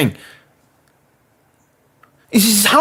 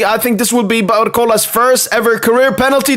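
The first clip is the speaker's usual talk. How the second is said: ordinary delivery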